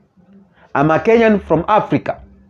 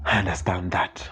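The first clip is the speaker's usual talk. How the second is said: softly whispered aside, much quieter; 8 semitones lower, 11 dB quieter than usual. Real, not acted. Whispering to himself while reading a comment. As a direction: breathe it out under your breath.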